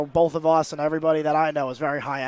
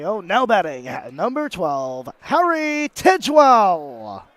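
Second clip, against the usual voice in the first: echoing stadium announcer; much slower than usual. Shown as a demonstration. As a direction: boom the batter's name over the stadium. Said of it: "for me, it'd be like".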